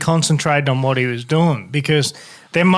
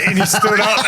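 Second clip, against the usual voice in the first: normal talking voice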